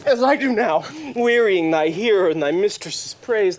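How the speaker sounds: usual speaking voice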